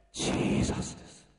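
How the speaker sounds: a hushed reveal of the answer; whispered answer. Real, not acted. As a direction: breathe the name, voiceless. Answer after loud questions. (ささやき声で)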